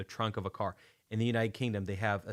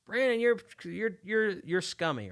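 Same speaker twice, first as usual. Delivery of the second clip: loud, high mock complaint; mocking hater; 10 semitones higher, 5 dB louder than usual. British listeners calling the host scummy, high mock voice. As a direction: put on a high, insulting listener voice. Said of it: "And they say".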